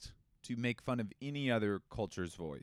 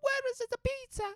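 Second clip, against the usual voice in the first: very high squeaky dolphin voice; 3 dB louder than usual. Pretend. Host plays a dolphin hunting pizza, very high and squeaky. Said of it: "I still can't make a dolphin noise".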